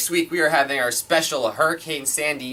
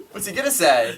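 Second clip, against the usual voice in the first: ordinary delivery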